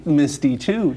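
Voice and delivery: ordinary delivery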